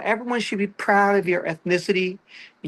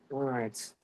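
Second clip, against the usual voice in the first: normal talking voice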